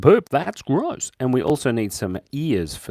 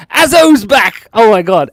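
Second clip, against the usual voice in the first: high, loud squeal of delight; thrilled welcome back; excited greeting; 10 semitones higher, 13 dB louder than usual. Real, not acted. Greeting returning viewer.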